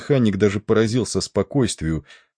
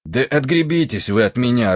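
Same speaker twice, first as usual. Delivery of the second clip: raised, annoyed outburst; irritated; 6 semitones higher, 4 dB louder than usual. A narrator's reading. Stoker telling the officer to leave him be, irritated. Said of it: "раздражённо сказал кочегар".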